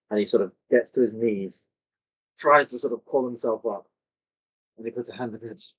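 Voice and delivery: ordinary talk, nothing marked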